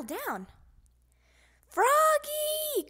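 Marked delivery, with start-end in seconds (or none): 1.7-2.9 long high loud call. Acted. Father calling Froggy to wake up, long loud call. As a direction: call him from across the house. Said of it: "called his father".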